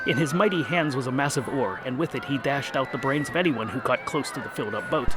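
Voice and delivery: usual speaking voice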